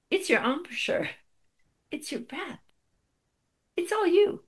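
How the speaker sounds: pointed teacher. Retold or imitated. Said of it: "He pointed to me and said".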